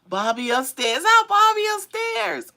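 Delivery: high, loud, delighted hello; happy to see a favorite viewer come back; excited greeting. Real, not acted.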